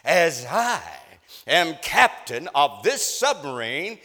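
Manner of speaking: loud, slow, high spaced-out scolding; captain up in his face, slow and furious; angry captain. Retold or imitated. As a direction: hammer each word into his face. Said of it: "And he said to me".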